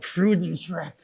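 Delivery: lightly put-on German accent; fake German accent. Shown as a demonstration. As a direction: say the title in a mock German accent. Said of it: "That's my fake German accent".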